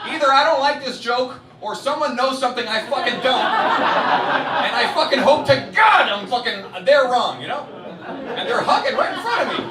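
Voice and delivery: loud, high-pitched exasperated outburst; outraged rant. Acted. Comedian acting out his indignation to the crowd, outraged rant. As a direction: shout it in mock outrage.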